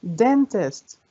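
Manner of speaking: "dentist" as DEN-tist, falling; stress on first syllable. Shown as a demonstration. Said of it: "أول مقطع برضو".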